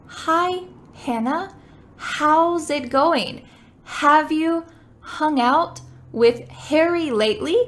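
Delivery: Hi, Hannah and Harry with breathy H's; emphasized H sounds. Shown as a demonstration. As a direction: exaggerate every H at the start. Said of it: "I'm emphasizing the H sound here".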